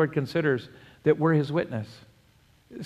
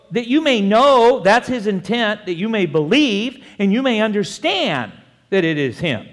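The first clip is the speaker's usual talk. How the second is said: forceful preaching; 6 semitones higher, 6 dB louder than usual. Acted. Preacher answering excuses with God's intent, forceful. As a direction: hammer the verse home.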